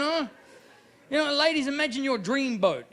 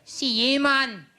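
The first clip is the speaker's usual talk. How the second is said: put-on sinister Glasgow accent; 5 semitones higher than usual. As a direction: threaten in a broad Glasgow accent. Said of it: "If you wanna be sinister".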